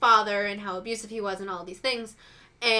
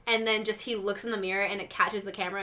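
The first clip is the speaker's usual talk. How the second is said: ordinary delivery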